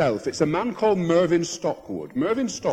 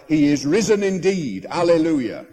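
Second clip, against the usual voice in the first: a whole room answering together, louder; loud chorus reply; 6 dB louder than usual. Retold or imitated. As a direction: boom back the Easter response. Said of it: "everybody in the shop replied".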